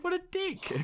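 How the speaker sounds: high mocking judgement; scornful squeak. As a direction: sneer it in a squeaky voice. Mock judgement of Ivan.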